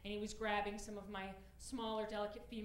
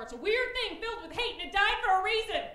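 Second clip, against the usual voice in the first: high, loud, building alarm; mock-horror warning, rising in force; dramatic warning; 8 semitones higher, 10 dB louder than usual. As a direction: build to an emphatic comic outburst. Horror-style warning about exes, building.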